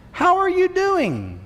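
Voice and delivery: mock innocent greeting. A passerby casually asking how you are, mock innocent. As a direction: ask it like an overly sweet casual question. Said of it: "that oh so innocent question".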